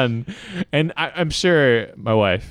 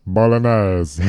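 mock Italian accent; 5 dB louder, slower than usual. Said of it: "How would he say in Italian?".

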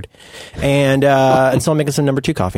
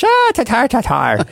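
mock Irish exclamation; 9 semitones higher, slower than usual.

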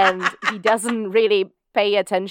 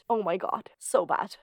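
soft unvoiced dismay; horrified whisper; 6 dB quieter than usual. Real, not acted. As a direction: breathe it out, horrified. Horrified after self-harm scene.

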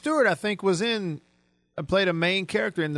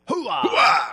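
loud, high shouted catchphrase; Al Pacino; 6 semitones higher, 5 dB louder than usual. Acted. Host repeating his favorite impression, big shouted bellow. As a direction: bellow it like the movie star. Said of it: "I like the hoo-ah better".

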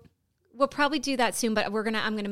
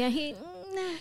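mock sob; 6 semitones higher, 8 dB quieter than usual. Undertaker voiced breaking down.

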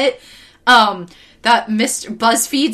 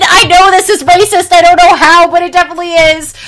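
louder, higher mock indignation; mock-indignant critic, louder and higher; indignant mock quote; 5 semitones higher, 6 dB louder than usual. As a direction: ham up the outrage, fast at the end. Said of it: "Cosmonaut Theater was like".

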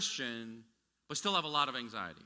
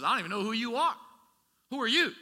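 raised, rising rebuke, high and loud; Jesus' rebuke; 7 semitones higher, 6 dB louder than usual. Acted. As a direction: call it out as Jesus turning them away. Jesus rejecting false followers.

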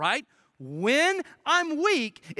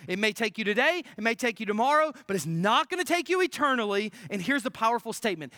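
normal talking voice

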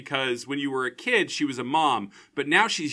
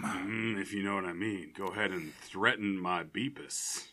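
hammed-up bedroom murmur; mock-sultry innuendo; 7 semitones lower, 9 dB quieter than usual. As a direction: drop deep and quiet, play seductive. Joins in the mock-sultry bit.

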